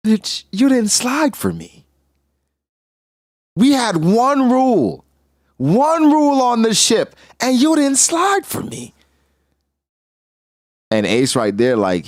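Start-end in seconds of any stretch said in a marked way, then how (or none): 0.1-8.9 much higher, slow complaining voice; whiny Thatch. Retold or imitated. Thatch scolding Whitebeard, high slow whine. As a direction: pitch way up and whine it slowly.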